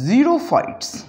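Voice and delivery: xerophytes said with the X as a Z sound. Shown as a demonstration. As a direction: start xerophytes with a Z. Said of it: "pronounce करते हैं as Z".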